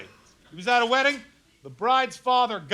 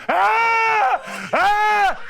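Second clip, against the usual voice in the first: crying wails; 12 semitones higher than usual. Shown as a demonstration. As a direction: let out short wailing sobs. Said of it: "the way I cry".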